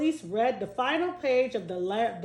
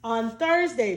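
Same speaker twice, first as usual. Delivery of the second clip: plain reading voice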